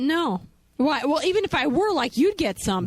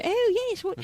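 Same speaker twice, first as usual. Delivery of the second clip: mock English accent; 8 semitones higher, slower than usual. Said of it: "they thought I was making fun".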